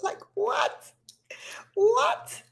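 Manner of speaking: can't believe you did that; incredulous high "what". Real, not acted. Disbelief at own story.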